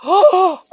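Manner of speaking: loud gasp into a cry; sharp gasp of disbelief; shocked gasp. Real, not acted. Goal moment, commentator erupts.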